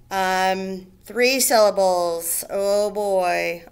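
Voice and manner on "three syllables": usual speaking voice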